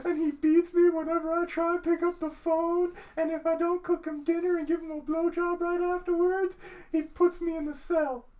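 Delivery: high falsetto talk show woman; hammed-up squeaky victim voice; mock woman voice. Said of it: "the chick's like".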